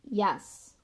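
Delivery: usual speaking voice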